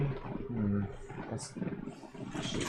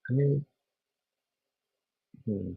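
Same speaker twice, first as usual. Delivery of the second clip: low quality audio. Real, not acted. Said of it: "why does it sound so low quality".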